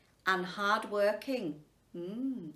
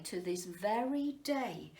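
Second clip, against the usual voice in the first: ordinary delivery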